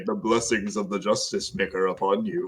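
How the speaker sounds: low, soft, measured blessing; calm, collected. Acted. Player's character blesses the small rogue, calm collected voice. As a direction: bless them quietly and with composure. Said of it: "just a calm, collected voice say".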